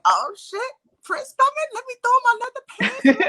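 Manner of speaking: gleeful thrill, breaking into laughter; opgewonden reactie. Retold or imitated. Retells own giddy reaction to news.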